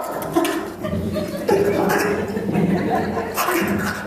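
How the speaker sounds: high, airless wheezing laugh; breathless laugh. Real, not acted. Narrator laughing at memory.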